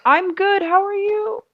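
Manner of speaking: high, squeaky playful answer; mock cheery reply. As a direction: answer the ghost in a put-on high voice. Player answers the ghostly voice.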